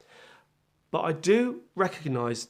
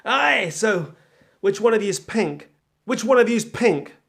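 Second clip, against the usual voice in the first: put-on loud, higher-pitched botched American accent; hammed-up, not-quite-American twang; failed American accent; 5 semitones higher, 7 dB louder, slower than usual. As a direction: put on a bad American accent as a brash record exec. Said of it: "Trying to do an American, American accent".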